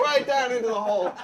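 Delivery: ordinary delivery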